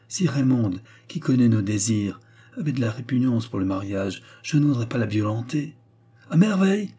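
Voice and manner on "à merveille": high-pitched sarcastic outburst; sharp, higher exclamation; stung interruption. A narrator's reading. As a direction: cut in, goaded by resistance. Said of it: "interrompit la dame, aiguillonnée par cette résistance".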